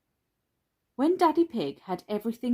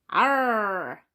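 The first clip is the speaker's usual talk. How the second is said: put-on drawn-out child's pirate arr; 3 semitones higher, 3 dB louder than usual. A narrator's reading. George excited about a pirate ship, mock pirate cry. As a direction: growl out a playful pirate cry. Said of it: "cried George in his best pirate voice".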